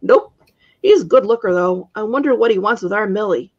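ordinary delivery, nothing marked

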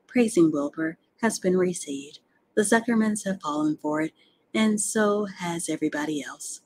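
usual speaking voice

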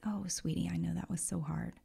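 soft, hushed and caring; loving inner voice soothing a sad friend; tender whisper. Pretend. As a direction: whisper it like a gentle parent. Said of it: "developed this relationship that says".

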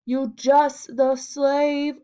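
sneering. A narrator's reading. Major taunting Alfred about his job, slow sneer. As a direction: drag it out with contempt. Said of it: "sneered Major".

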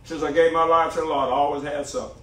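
ordinary talk, nothing marked

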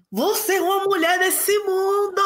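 high, slow imitation of a stern mother; hammed-up lecturing parent voice; a mother drilling her daughter to be tough, high and slow.

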